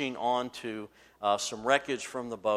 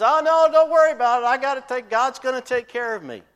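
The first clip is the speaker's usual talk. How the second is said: much higher, loud and fast dismissal; breezy refusal; 14 semitones higher, 13 dB louder, faster than usual. Retold or imitated. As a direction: brush them off cheerfully. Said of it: "he says".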